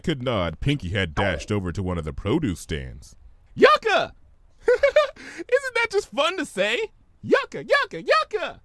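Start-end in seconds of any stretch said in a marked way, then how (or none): 3.5-4.1 high, loud delighted cry; gleeful squeal. Acted. Pinkie to the vendor, a high gleeful squeal. 4.7-8.6 very high, giddy sing-song; bubbly Pinkie voice. Acted. Pinkie playing with words at the market, high and giddy.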